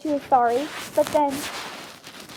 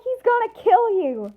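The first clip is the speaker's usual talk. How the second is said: high-pitched urgent warning; acted alarm; 7 semitones higher than usual. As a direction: warn a student of mortal danger, voice raised high.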